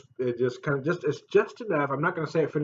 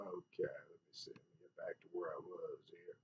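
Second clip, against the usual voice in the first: whisper; 9 semitones lower, 23 dB quieter than usual. Real, not acted. Fiddling with the camera.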